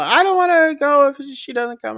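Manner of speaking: put-on high, whiny little-girl voice; whiny child. Girl refusing to go without her doll, whiny. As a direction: pout it like a stubborn kid.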